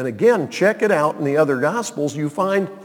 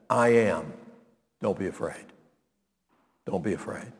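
reassuring Jesus; 7 semitones lower, 8 dB quieter than usual. Acted. Jesus calms terrified disciples.

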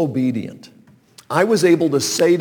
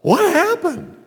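surprised; 4 dB louder than usual. Retold or imitated. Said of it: "And I thought".

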